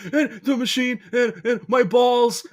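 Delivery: high, slow mock wail; panic crying voice. Co-host imitating host's golf-course injury, high slow mock wail. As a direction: whimper it out high and slow like he is hurt. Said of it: "Every time you do that voice".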